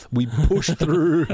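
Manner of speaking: slow, drawn-out mock triumph; mock-heroic strain. Host boasts about the podcast in mock-heroic drawl. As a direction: stretch it out with pushed-up pitch.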